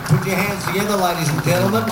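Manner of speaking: ordinary talk, nothing marked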